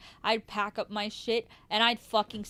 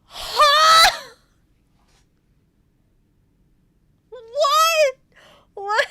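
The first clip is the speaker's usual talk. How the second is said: shrieked, much higher, louder; stunned disbelief; 14 semitones higher, 8 dB louder, slower than usual. Real, not acted. Hearing the fake pregnancy story, a shrieked "what". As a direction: scream out your disbelief.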